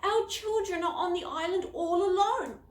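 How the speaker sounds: urgent, high and loud on the phone; a frantic father pleading with the Coast Guard; worried parent. Acted. A worried parent on the phone, pleading loudly.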